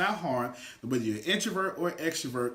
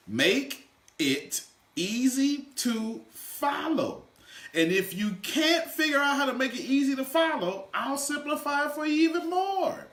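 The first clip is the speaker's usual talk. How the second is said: building excitement; 9 semitones higher than usual. Real, not acted. Speaker to class, building to an excited shout.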